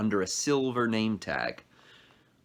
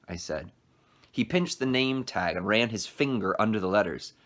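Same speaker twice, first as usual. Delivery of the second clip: plain reading voice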